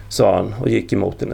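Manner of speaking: ordinary delivery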